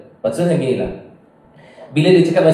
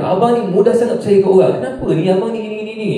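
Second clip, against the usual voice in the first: retold scolding wife; 7 semitones higher, faster than usual. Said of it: "wife saya marah saya".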